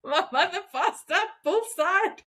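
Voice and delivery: laughing through the words; amused retelling, laughing as you speak. Real, not acted. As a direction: say it while giggling at the memory. Same line retold, laughing.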